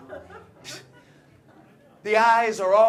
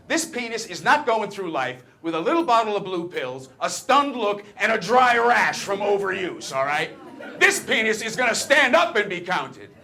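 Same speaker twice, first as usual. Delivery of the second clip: louder and louder, building to a rallying cry; defiant mock speech; 3 semitones higher, 6 dB louder than usual. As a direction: rant it like a rousing declaration. Comic defiant rant, building.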